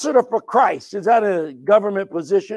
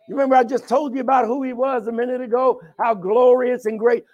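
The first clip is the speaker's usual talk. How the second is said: ordinary delivery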